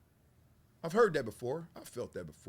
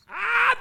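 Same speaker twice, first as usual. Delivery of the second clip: laughing cry; 8 dB louder than usual. Real, not acted. Laughing at own joke.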